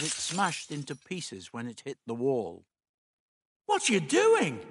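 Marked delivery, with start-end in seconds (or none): 3.7-4.6 high-pitched cry of protest. A narrator's reading. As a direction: exclaim it in alarm, pitch leaping up. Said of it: "Dodo cried out".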